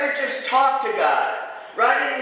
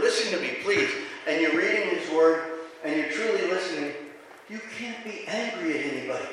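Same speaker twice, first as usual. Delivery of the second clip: ordinary delivery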